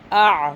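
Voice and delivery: آ squeezed from the throat as ain, loud; throaty ain. Shown as a demonstration. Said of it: "سیکھنا ہے کہ عین". (آ حلق سے، عین کی طرح)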